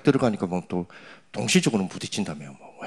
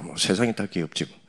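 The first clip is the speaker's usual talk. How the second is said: ordinary talk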